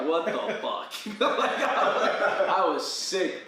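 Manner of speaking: laughing hard through the words; words squeezed out between laughs; half-talking, half-laughing. Real, not acted. Laughing retelling shocking moment.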